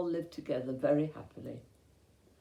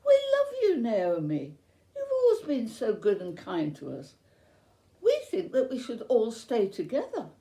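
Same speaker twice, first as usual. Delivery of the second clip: lightly voiced young women; 6 semitones higher, 5 dB louder than usual. Daughters-in-law pleading with Naomi.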